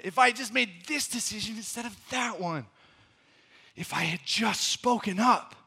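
high, frustrated self-reproach; acted regret. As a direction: kick yourself out loud over a missed chance. Acts out moments of regret.

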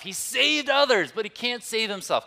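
high, loud mocking jeer; sneering shout. Preacher acting out the rulers jeering Jesus on the cross, shouting mockingly. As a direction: shout the taunt high over a crowd. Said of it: "sneered at him, shouting".

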